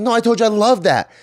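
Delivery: high, fast mock protest; exasperated retort. Daughter mimics correcting mom again, high and fast. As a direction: rush the correction, fed up. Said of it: "I'm like".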